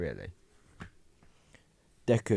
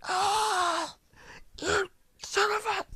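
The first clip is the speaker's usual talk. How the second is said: high-pitched pained shriek; shrill cry of agony, cut short; agonized scream; 4 dB louder, much slower than usual. Acted. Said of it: "He screams in agony".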